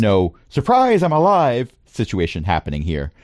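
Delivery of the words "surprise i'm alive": high, bright mock gasp of surprise. DM mocking a dramatic surprised return. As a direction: play up a fake shocked reveal.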